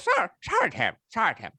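a hint of a pirate voice, pitched high; piratey. Apologising to Tim in a lightly put-on pirate voice. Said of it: "went a bit piratey there".

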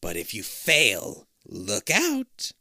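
slow, ominous monster threat; menacing threat. Acted. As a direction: warn darkly. Queen threatening.

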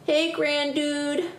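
slow, high cow greeting; mooing cows. Acted. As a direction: stretch it out like a friendly cow. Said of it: "mooed some friendly cows".

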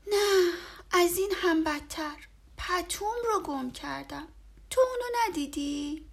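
put-on high, loud childlike lament; a sad boy telling his friend; sighing little boy. A narrator's reading. Boy tells Bear his blanket is lost, sad. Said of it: "آه بلندی کشید و گفت".

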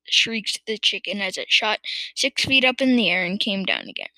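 plain reading voice

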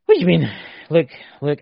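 mock indignation. Real, not acted. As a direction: push back loudly, as if affronted. Mock outrage at American's translation remark.

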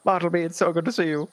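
high, giddy delight; childlike freakout. Acted. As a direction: gush like an overexcited child. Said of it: "Lawrence is just absolutely freaking out, being a child".

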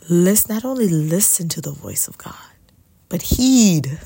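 whispered build that punches "heed" loud; imitating the friend, hit "heed" hard at the end; heavy stress on heed. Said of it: "emphasized the word heed".

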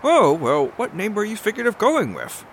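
high-voiced, halting adventurer; Odysseus, politely curious. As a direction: play a high, tentative, courteous hero. Plays Odysseus in the game.

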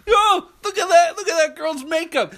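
loud, high jeering shout; loud teenage taunt; mocking yell. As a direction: jeer at her makeup across the hall. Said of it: "He goes".

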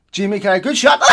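loud, high, laughing banter; hammed-up pundit chortling out a compliment; mock banter. Mocking pundits' banter on a TV show, laughing loudly. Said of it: "And then some shit banter".

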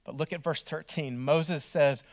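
normal talking voice

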